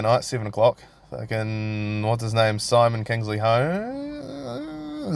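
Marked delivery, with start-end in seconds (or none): 2.6-5.2 drawn-out unsure question. Real, not acted.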